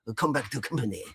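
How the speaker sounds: put-on gentle joking Japanese accent; mock Japanese accent. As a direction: playfully voice a Japanese promoter in a Japanese accent. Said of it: "when you do a Japanese accent".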